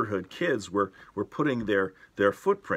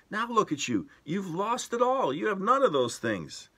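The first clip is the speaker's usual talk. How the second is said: much higher, outraged scolding voice; wife yelling; 11 semitones higher than usual. Retold or imitated. Wife scolding the professor for paddling a kid, high outraged yell. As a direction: scold him like a horrified wife. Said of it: "and she yelled".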